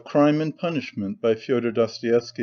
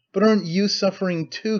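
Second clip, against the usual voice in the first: high-pitched, pleading question; higher, urgent cry of concern; 7 semitones higher than usual. A narrator's reading. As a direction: exclaim it, voice raised in pitch. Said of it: "cried Sonia".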